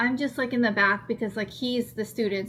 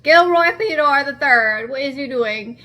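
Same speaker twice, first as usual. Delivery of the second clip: calling out; 4 semitones higher, 11 dB louder than usual. Real, not acted. Calling out to the squirrel.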